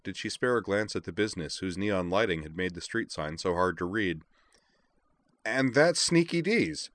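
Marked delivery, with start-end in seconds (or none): 5.4-6.9 high, slow, savoured announcement; drawn-out, pitched-up triumph; triumphant discovery. A narrator's reading. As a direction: announce the long-sought find.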